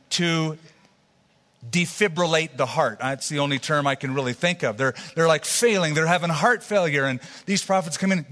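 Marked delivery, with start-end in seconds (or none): none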